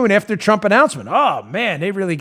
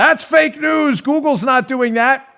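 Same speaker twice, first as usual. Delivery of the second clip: mocking the media; 8 semitones higher, 4 dB louder, slower than usual. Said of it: "and the media said".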